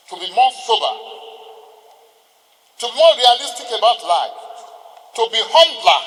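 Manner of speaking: swelling into a firm call to be humbler; building exhortation. Real, not acted. Exhorting new senior advocates.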